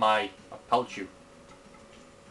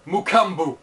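monster name in a mock African accent, louder and higher; 4 semitones higher, 6 dB louder than usual. Gamer naming a new monster, mock African accent. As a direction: Mukambu with rolled open vowels in an African accent. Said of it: "you have to say it in an African accent".

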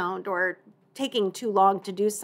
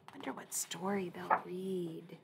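ordinary talk

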